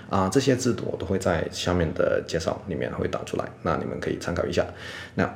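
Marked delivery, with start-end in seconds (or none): none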